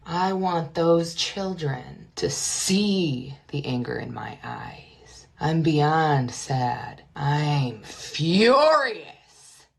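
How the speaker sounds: very slow, low, deliberate delivery; slow dramatic menace. Acted. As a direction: drag out every word like a threat. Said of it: "just the worst acting".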